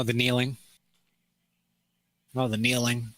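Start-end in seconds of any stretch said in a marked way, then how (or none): none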